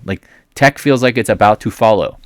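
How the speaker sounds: usual speaking voice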